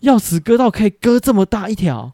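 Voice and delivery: can a key really do that?; real shocked question; surprised disbelief. Real, not acted. Disbelief at friend's scar.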